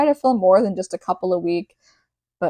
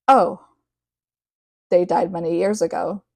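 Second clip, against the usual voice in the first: "they died many years ago" stated flat and even, no weight on "died"; just state it like it's nothing; casually matter-of-fact about a dead family. Retold or imitated. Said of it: "Tone was matter of fact".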